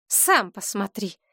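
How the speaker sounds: ordinary delivery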